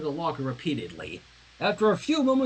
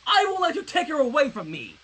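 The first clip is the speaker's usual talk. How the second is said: yelling; 8 semitones higher than usual. Acted. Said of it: "Twilight yelled".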